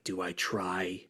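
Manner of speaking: soft, low pondering; imagined musing. Retold or imitated. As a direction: play him quietly weighing it up. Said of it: "where he was like".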